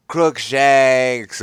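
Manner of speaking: lightly voiced shout; understated battle cry; mock spell shout. A narrator's reading. Ebony fighting Voldemort, mild shout. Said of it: "I shouted at him".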